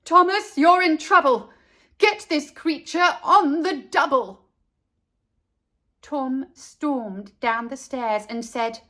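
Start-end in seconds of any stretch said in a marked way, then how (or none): 0.0-4.5 loud angry mum holler. A narrator's reading. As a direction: call out to Thomas in a scolding holler. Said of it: "Mum hollered".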